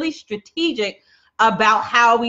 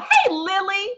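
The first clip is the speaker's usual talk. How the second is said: retold yell; 9 semitones higher than usual. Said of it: "I literally yelled".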